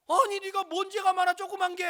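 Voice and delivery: very high, loud indignant outburst; mother scolding. Retold or imitated. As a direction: imitate a mother's shrieked rebuke. Mother scolds child in story.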